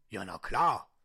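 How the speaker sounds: low, loud put-on voice; disguised deep voice. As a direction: boom it out low. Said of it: "meine Stimme zu verstellen".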